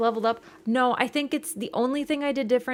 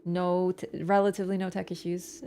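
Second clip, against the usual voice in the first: usual speaking voice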